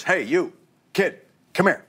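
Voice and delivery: put-on short summons to a kid; director calling out. Director calls over the extra holding a football, calling out.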